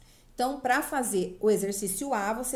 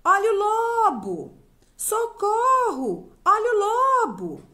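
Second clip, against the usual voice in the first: high, very loud drawn-out cry of alarm; shouting for help; 10 semitones higher, 9 dB louder, slower than usual. A narrator's reading. Shepherd boy cries wolf to the villagers, shouting. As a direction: call out slowly as the shepherd boy raising the alarm.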